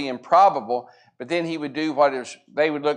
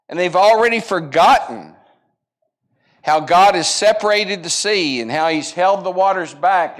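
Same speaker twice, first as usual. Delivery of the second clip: louder, impassioned sermon delivery; fervent preaching; 4 semitones higher, 6 dB louder than usual. Acted. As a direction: drive the point home to the congregation. Preaching builds to full force.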